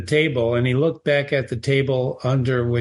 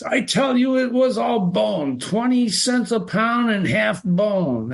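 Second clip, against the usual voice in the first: higher angry woman complaining; 8 semitones higher than usual. A narrator's reading. Woman complaining to butcher about bone, higher angry voice. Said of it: "an angry woman's voice".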